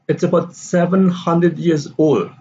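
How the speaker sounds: put-on SEVEN high, then falling through "years old"; stress on SEVEN. Shown as a demonstration. Teacher's answer to Dave, stress on SEVEN. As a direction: hit "seven" and leave "old" unstressed. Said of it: "seven is, uh, stress here".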